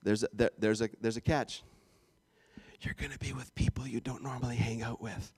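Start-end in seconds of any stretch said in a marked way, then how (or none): 2.8-5.3 low, near-whispered confession; mock-secretive hush. Preacher reveals the catch about gathering groups, hushed. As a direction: drop it low and soft, like a dreaded catch.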